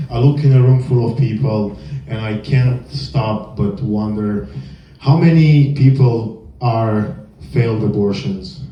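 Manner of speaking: usual speaking voice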